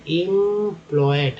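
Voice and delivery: Employed with a long held first syllable; teacher stretching employed in parts; sounding out. Shown as a demonstration. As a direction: hold the opening of employed, then finish it. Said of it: "ईएम को इम बोलते हैं".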